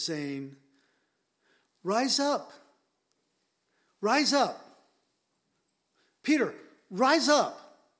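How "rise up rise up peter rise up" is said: rising to a loud, high call, building with each repeat; commanding call. Acted. Jesus calling fallen Peter to his feet, building loud command. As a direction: call him up, louder each time.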